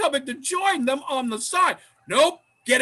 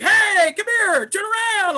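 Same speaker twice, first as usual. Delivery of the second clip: high, louder cop call; mock cop; 9 semitones higher, 5 dB louder than usual. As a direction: call him over then order. Cop voiced again in retelling.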